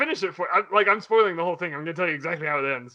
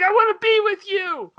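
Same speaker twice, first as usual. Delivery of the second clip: high, loud hammed-up whine; pleading kid; 13 semitones higher, 6 dB louder than usual. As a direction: beg like a clingy child. Kid begs the creature.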